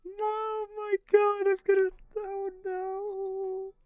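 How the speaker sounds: very high, drawn-out wail; mock despair. Streamer reacting to a suspect explaining slang, high mock wail. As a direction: squeal it in hammed-up dismay.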